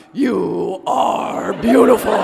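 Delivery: slow, loud, drawn-out compliment; a mock-deep, loud crooning to the bear; super deep voice. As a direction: boom it out slowly like a hammed-up giant. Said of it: "in my super deep voice".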